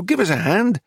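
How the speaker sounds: high-pitched call, mildly shouted; shouting. A narrator's reading. Narrator calls Nero over to help lift, mild high shout. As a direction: raise the pitch as if calling for help. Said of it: "I shouted at Nero".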